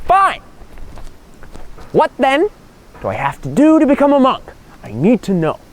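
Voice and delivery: high exasperated outburst; snapping. Acted. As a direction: snap and demand an answer, pitch soaring. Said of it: "the guy snaps".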